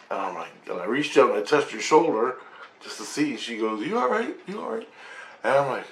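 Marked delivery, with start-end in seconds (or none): 3.9-4.9 much higher, softer mimicked voice; acted concerned question. Girl checks on him after shoulder touch, high soft concern. Concerned girl's quote in retelling.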